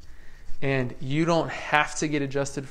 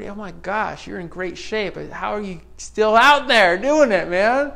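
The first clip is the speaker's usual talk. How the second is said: higher and louder, amazed; excited amazement; 5 semitones higher, 7 dB louder than usual. Retold or imitated. Praising the sixty-year-old painter, loud excited amazement. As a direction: cheer him on in disbelief. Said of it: "I thought".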